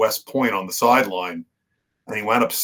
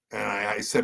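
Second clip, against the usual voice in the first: usual speaking voice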